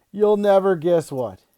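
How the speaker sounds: ordinary delivery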